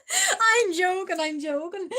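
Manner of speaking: high, drawn-out words through real laughter; laughing. Real, not acted. Streamer taking back a tease to a viewer, laughing. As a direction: laugh it off while talking.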